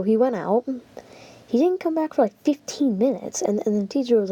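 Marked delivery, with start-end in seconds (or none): none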